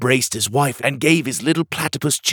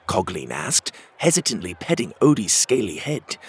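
ordinary delivery, nothing marked

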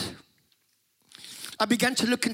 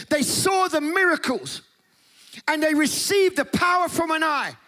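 preaching; 6 semitones higher than usual. Acted. Preacher stirring up the church about the Holy Spirit, fervent preaching. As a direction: preach it at full force to the congregation. Preacher builds revival message.

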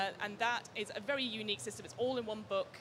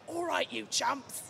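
put-on loud rowdy East London accent, higher; 7 semitones higher, 5 dB louder, much slower than usual. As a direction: shout it like an East London geezer. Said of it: "gonna get my East London accent out".

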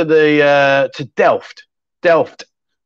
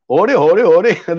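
louder, hammed-up mock Dutch sing-song; terrible Dutch accent; 3 semitones higher, 4 dB louder than usual. Streamer jokingly playing the Dutchman for Graham, terrible Dutch accent. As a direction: do a badly faked Dutch accent. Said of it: "That's a terrible Dutch accent".

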